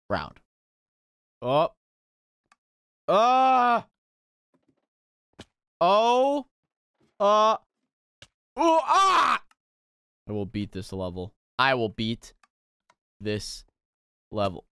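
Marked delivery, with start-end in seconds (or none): 3.1-9.4 short high yells rising to a scream; loud, high exasperated outbursts; frustrated yells. Real, not acted. Failing a jump in game. 10.3-11.3 quiet, low self-pep-talk; hushed, low resolve; low determined vow. Real, not acted. Vowing to beat the level. 11.6-14.6 low, spaced-out determination; grimly measured, low and halting; word-by-word vow. Real, not acted.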